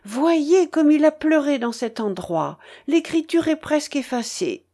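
high-pitched, emotional appeal; moved exclamation. A narrator's reading. Marguerite showing monsieur the tear-stained page, moved exclamation. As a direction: raise the voice as if deeply touched.

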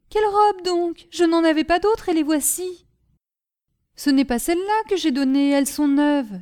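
startled sister questioning her brother; alarmed sister. Acted. Caroline answers, alarmed. (sœur inquiète, voix plus aiguë)

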